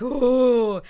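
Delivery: drawn-out hammed-up "ooh"; imitated pained "ooh". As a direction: react like you just got slapped. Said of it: "He's like".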